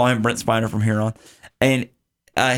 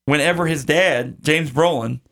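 ordinary talk

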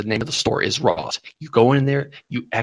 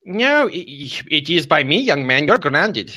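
slightly raised, drawn-out mock mother voice; scolding mom; 4 semitones higher, slower than usual. Mom grounding the young prank caller, mock stern. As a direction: lay down the law as a pretend mom. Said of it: "I'm gonna get my mom".

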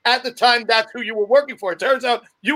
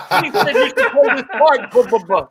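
Vinny Nardiello. Shown as a demonstration. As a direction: imitate him, tacking babble onto the end. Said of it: "so used to doing Vinny that we just add that "ba ba".

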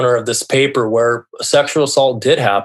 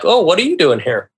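higher-pitched mock surprise; retold startled question, pitched up then falling; surprised; 8 semitones higher than usual. Party guests to the unexpected visitor, surprised. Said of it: "people are surprised to see me".

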